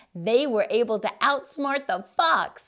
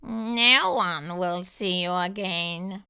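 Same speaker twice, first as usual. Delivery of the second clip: sly fox aside; 4 dB louder, slower than usual. Acted. Fox plotting to himself, slow sly aside. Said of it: "said the fox to himself".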